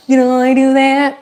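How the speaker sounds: high, squeezed comic catchphrase voice; Urkel impression. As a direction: pitch it up into a sitcom impression. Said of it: "The Urkel thing?".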